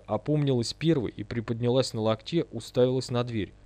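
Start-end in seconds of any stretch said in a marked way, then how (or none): none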